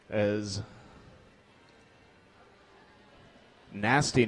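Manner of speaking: low, quiet concern; somber. Real, not acted. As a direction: soften for the fallen skater. Skater injured.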